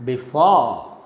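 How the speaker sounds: "before" stressed on FORE, falling; "before" with a weak be and a long, strong FORE; stress on second syllable. Shown as a demonstration. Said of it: "So the correct pronunciation is".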